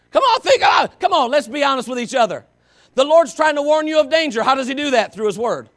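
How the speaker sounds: loud, driving sermon voice; full-force preaching. Acted. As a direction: push it home to the congregation.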